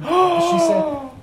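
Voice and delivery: slow, loud, high teasing buildup; dramatic build-up. Real, not acted. Server teasing coworkers with juicy gossip, slow and loud. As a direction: drag it out to build suspense.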